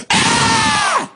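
sudden very high shriek; imitated scream. Preacher imitating the healed woman as power hits her, screaming. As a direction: shriek as if jolted by the touch. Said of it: "And she screamed".

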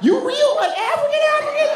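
squeaky high-pitched surprised Southern voice; Southern surprise voice. Retold or imitated. Southern guy amazed to meet an African, very high. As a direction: go way up high in shocked disbelief. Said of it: "their voices go up really high".

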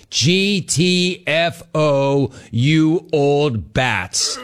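G T F O spelled out very slowly; slow deadpan read of a rude sign-off; slow punchline. Acted. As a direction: drag out each letter, G T F O. Reading caller's punchline.

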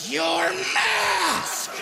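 high, shrill shout from nowhere; an old citizen yelling about a mask; scolding shriek. Acted. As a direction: bark it like a startled busybody. Old lady scolding, sudden.

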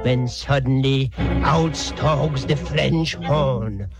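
put-on slow, drawn-out German-accented announcement; ominous narration. Storyteller brings on the villain, slow accented menace. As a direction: stalk through the words slowly.

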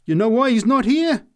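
high, loud, triumphant cry; triumphant answer. A narrator's reading. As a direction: fire it back in triumph. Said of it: "she answered triumphantly".

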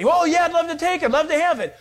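quick, high, eager yes. Retold or imitated. Brother eager to buy the land, quick and high. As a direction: jump at the offer.

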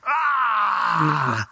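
a short shouted demon's cry Ah; demonic shout. Shown as a demonstration. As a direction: bark out a single demonic cry. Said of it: "it actually translates to this".

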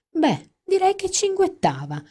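very high chirping mock tone; chirpy voice. Friend imitating Amanda's chirpy phone voice. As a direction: chirp it like a twittering bird. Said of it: "oppure cinguettava".

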